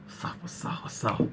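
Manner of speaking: hushed, repeated whisper. Pretend. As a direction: drop to a playful whisper. Playful aside, whispered repeats.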